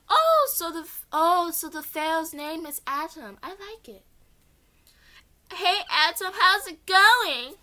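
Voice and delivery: cheerful greeting. Acted. Girl greets Atom after hugging him, cheerful. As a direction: greet him like an old friend.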